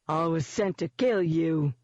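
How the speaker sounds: put-on London street-thug accent; seedy London accent. A narrator's reading. Demon thug tells his victim his errand, seedy London accent. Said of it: "in a seedy London accent".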